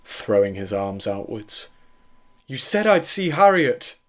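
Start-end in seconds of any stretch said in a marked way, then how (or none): 2.5-4.0 high, loud, desperate wail. A narrator's reading. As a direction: wail it as a betrayed plea. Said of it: "Doctor Jacobs wailed".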